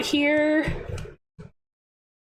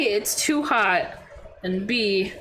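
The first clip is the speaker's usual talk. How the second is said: ordinary talk, nothing marked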